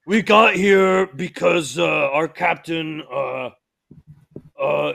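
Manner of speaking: loud, halting character voice; stammering captured crewman. Acted. Captured crewman explaining how they got here, loud and hesitant. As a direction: stall loudly as you explain yourself.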